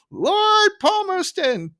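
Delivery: very high, drawn-out mock bellow; Barney. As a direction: insist on it in a stretched-out high shout. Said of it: "it's basically Barney going".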